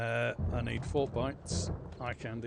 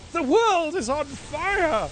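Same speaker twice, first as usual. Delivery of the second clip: high, loud and very slow doom cry; dramatic loud proclamation; 10 dB louder, much slower than usual. Pretend. Gamer setting the base on fire, proclaiming in a loud slow cry. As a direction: declaim it like the end of the world. Dramatic line while burning zombies.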